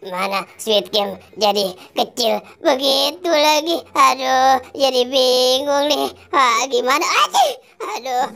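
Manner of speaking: ordinary delivery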